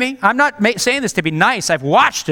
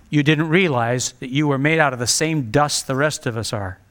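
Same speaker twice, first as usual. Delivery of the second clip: normal talking voice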